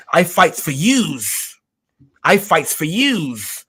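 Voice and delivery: slow Chicago Italian salesman pitch; Chicago Italian car salesman. Pretend. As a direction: sell it like a Chicago Italian used car dealer. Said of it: "like an old used car salesman in, like, Chicago, and he's, like, Italian".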